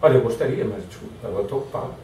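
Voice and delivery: gently turning someone away, "Olha, eu gostaria"; polite apology. Shown as a demonstration. Busy worker kindly apologizing to a colleague, softly. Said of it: "está realmente orientando a pessoa".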